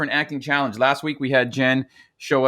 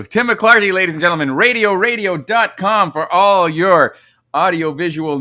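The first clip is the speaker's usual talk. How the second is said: hammed-up ad pitch to the crowd; mock announcer; 4 semitones higher, 5 dB louder than usual. Mock plug for co-host's company.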